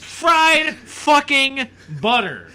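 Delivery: slow, high, drawn-out fried fucking butter; emphatic disbelief. Real, not acted.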